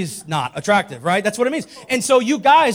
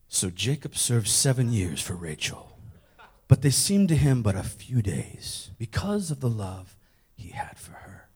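reverent romantic reading; 9 semitones lower, 3 dB quieter than usual. Shown as a demonstration. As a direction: savour each phrase, sinking to a hush. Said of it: "can I just read that portion again? Probably the way it deserves to be read".

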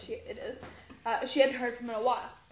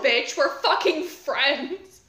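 slow, louder catty snap; bitchy teen retort; 7 dB louder, much slower than usual. Pretend. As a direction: drag it out like an offended teen. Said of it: "bitchy eighth grader".

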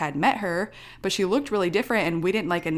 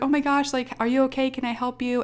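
higher-pitched, worried helper imitation; concerned worker; 6 semitones higher, faster than usual. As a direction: fuss over an upset customer.

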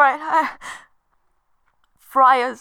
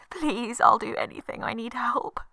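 desperate whisper; 6 dB quieter than usual. Acted.

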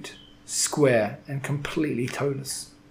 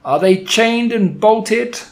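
slow, high and loud menacing witch; imperious witch demanding; booming witch voice; 8 semitones higher, 6 dB louder, slower than usual. Acted. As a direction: let it fill the room and bounce off the walls. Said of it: "in a voice that filled the room".

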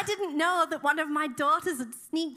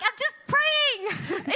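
high, drawn-out defensive wail; mock protest; 9 semitones higher, 3 dB louder, much slower than usual. Mother caught praying by her daughter, high mock protest. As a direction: stretch it out high in comic indignation.

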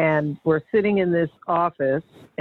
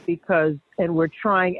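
ordinary talk

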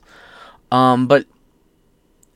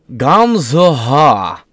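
a mock foreign accent, loud and high on Gamzuha; joking foreign accent; 4 semitones higher, 7 dB louder, slower than usual. Streamer reading place names jokes in a mock foreign accent. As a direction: ham up a foreign-sounding accent on the place name. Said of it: "The first one I was jokingly doing an accent".

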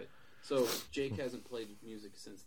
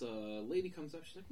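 ordinary talk, nothing marked

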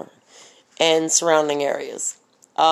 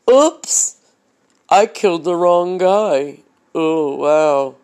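dumb killer voice; slower than usual. Pretend. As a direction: play the careless shooter, airheaded. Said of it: "and then say".

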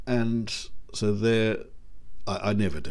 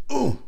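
squeaky high stuntman oof; a yelp as if hit; mock oof; 4 dB louder than usual. Mock oof when the stuntman gets hit. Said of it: "or Rogers".

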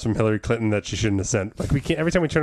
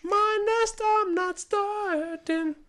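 very high, slow falsetto; much slower than usual. Acted. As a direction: squeak it out slowly.

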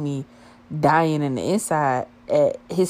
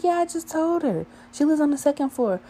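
put-on high girly voice, higher; imitating a girl; 12 semitones higher than usual. One girl telling her friend he lives there, high girly voice. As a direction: pitch up into a chatty girl's voice. Girl quoted at front desk.

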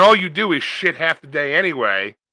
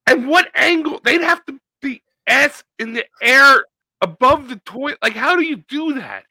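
high-pitched incredulous questioning; raised, exasperated wonder; baffled disbelief; 9 semitones higher, 4 dB louder than usual. Real, not acted. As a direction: demand an explanation, utterly baffled. Baffled by the story.

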